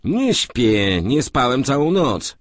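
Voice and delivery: a hint of a sleepless roommate; roommate answering. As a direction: call back from your bed. Moryc answers from bed.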